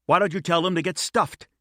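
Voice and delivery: high, pushed shout of defiance. A narrator's reading. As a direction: yell it at her, pitch way up. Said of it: "he shouted at her".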